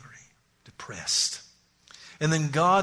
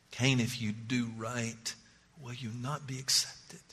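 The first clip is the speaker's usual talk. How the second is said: gentle voice of God; 3 semitones lower, 8 dB quieter than usual. Acted. God softly pleading with Cain to do right.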